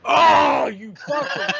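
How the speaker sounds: a real, loud yelp of disbelief that cracks into a laugh; surprised shout. Real, not acted. Host yelling at a cohost who guessed right, surprised shout. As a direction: shout it like you can't believe he got it. Reacts to correct trivia answer.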